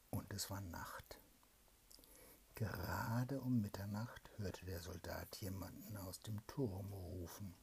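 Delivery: hushed whispered storytelling; bedtime whisper. A narrator's reading. Mother reads a bedtime tale to her child, whispering.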